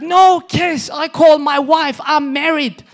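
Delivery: high, very loud mock outrage; outraged refusal. Married grocery packer refusing mom, hammed-up outraged shout. Man refuses, threatens to call wife.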